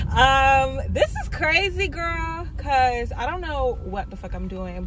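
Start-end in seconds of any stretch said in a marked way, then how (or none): none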